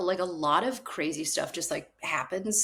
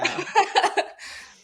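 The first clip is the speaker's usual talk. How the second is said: airy high-pitched laugh; breathy high laugh; 3 dB louder than usual. Real, not acted. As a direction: laugh at own line. Laughing at her own joke.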